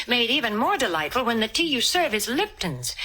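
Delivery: ordinary talk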